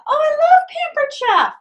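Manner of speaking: high, slow gushing voice; swooning fan of the brand; gushing customer. Retold or imitated. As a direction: gush like a delighted shopper.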